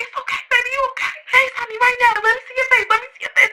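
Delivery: high-pitched, urgent fussing; imitated worried mother. Mom begging to see her daughter's face, mock frantic. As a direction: play a mom near panic.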